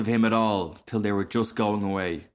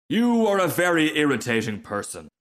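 higher-pitched indignation, falling away; irritated; 6 semitones higher, 3 dB louder than usual. Acted. Rocket rebuking the frog, irritated. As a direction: scold with wounded dignity.

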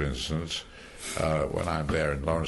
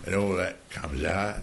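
ordinary talk

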